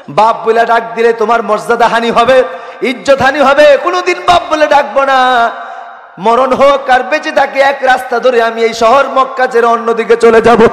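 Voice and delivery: high, loud, impassioned plea in preaching tones; desperate daughter. Acted. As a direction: cry out the child's promise at full pitch. Daughter makes desperate promise to father. (মেয়ের মরিয়া আকুতি, উঁচু গলায়)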